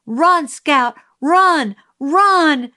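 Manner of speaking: high, loud urgent yell; screamed warning. A narrator's reading. Jim screaming to Scout as the attacker runs, urgent yell. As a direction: scream it as an order to flee. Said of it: "Jim screamed".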